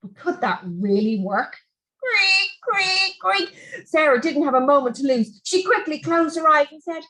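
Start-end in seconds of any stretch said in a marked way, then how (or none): 2.0-3.9 creak sound. A narrator's reading.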